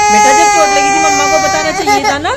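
high, loud worried telling-off; loud worried scolding. Real, not acted. Mother scolds hurt child.

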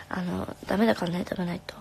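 soft whispered scolding; hushed caring nag. Real, not acted. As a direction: whisper a gentle warning. Gently scolding fan to eat.

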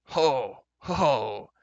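big hearty bellowed laugh; two loud, high, booming ho's. A narrator's reading. Giant king laughs at the warning, bellowing. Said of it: "King Smoit bellowed".

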